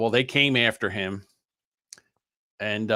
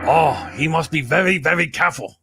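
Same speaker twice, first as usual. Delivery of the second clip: mock Japanese accent; 2 semitones higher, 3 dB louder than usual. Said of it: "if he did this".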